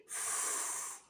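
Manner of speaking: "f" as a long voiceless hiss with no vowel; f sound. Shown as a demonstration. Teacher showing the children the letter sound, a voiceless f hiss. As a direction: push air through the teeth for the bare f. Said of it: "que tem o som de".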